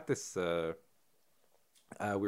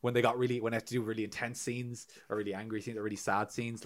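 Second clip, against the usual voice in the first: normal talking voice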